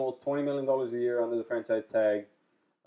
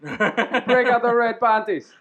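high, slow, gleeful call; higher, slowed hyped-up cheer; mock hype; 8 semitones higher, 4 dB louder, much slower than usual. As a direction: yell it like a fan celebrating a payday. Hyped about McGregor fight.

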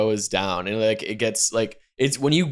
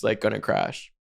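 ordinary talk, nothing marked

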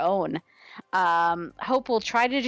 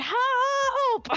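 sudden high yelled name, much higher; retold scream. Fan shouting at the TV as the ending hits, high scream. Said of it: "I screamed".

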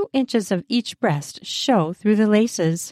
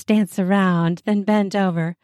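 ordinary delivery

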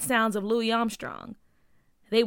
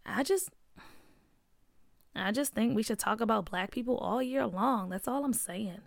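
mumbled under her breath; 7 dB quieter than usual. Acted. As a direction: mutter it under your breath. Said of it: "McKinley mumbled under her breath".

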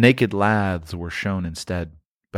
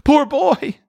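high, plaintive cry of pity; sudden pitying exclamation, pitched way up; 4 dB louder than usual. A narrator's reading. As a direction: cry out in pity for your younger self. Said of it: "he said in pity for his former self".

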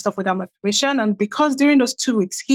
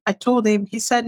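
ordinary talk

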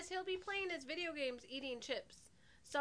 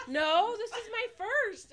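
put-on higher, louder, laughing through a kid's protest; laughing kid denial; 7 semitones higher, 11 dB louder, slower than usual. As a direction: imitate the son's denial while cracking up. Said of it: "And he's like".

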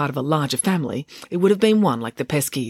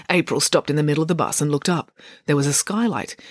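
usual speaking voice

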